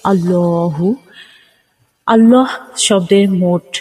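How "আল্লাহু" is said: "আল্লাহু" with the middle vowel held about a second; long held vowel after the L in "আল্লাহু"; drawn-out lam. Shown as a demonstration. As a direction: stretch the middle of "আল্লাহু", as "Allooo-hu". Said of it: "টেনে পড়তে হয়".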